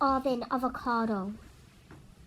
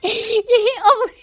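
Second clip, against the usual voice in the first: sudden loud outburst; surprised shout; 7 semitones higher, 11 dB louder, slower than usual. Real, not acted. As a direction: blurt it out loudly. Sudden shout while gaming.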